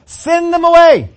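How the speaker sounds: loud, high, slow curt order; bossy demand. Retold or imitated. Disciples ordering Jesus to send the crowd off, loud bossy demand. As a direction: tell Jesus to get rid of them. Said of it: "they come up to Jesus and look".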